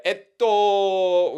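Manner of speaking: high, loud, long-held "etto"; imitated Japanese filler. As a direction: stretch out "etto" while hesitating.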